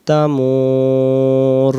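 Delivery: slow, chanted Quran recitation with drawn vowels. Shown as a demonstration. Teacher reciting the verse for students, slow and chanted. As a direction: recite the verse slowly, each vowel held.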